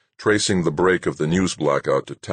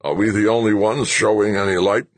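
put-on loud, high, demanding old man; raised voice; 6 semitones higher, 5 dB louder than usual. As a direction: push the question up and out. General demands, rising anger.

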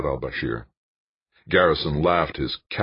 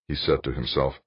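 ordinary delivery, nothing marked

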